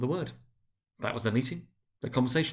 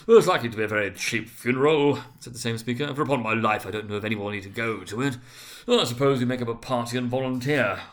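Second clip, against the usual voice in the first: loud fat merchant voice; 6 dB louder than usual. A narrator's reading. Fat man jokes.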